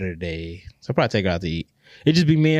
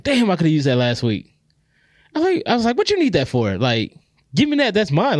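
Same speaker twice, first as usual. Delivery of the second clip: higher-pitched, mock-petulant complaint; indignant protest; 6 semitones higher than usual. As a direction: replay a petty objection, pitched up. Retelling his petty protest to daughter.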